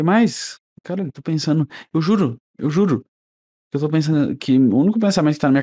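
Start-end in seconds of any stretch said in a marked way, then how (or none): none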